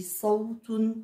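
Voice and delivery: Sawtun with a full, heavy emphatic S; teacher shows the swollen Sad in Sawtun; puffed-up Sad. Shown as a demonstration. Teacher models the heavy Sad for the children. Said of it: "il est gonflé".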